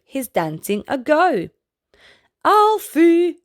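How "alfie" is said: much louder, higher call. Acted. Eloise the elephant calling out to Alfie, loud and higher. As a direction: cry his name across the pool. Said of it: "cried Eloise".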